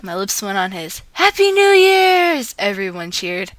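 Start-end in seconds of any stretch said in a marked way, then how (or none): 1.1-2.5 high, slow, loud cheer; stretched-out party cheer; cheering shout. Acted. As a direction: shout it like a midnight crowd. Crowd cheering at midnight.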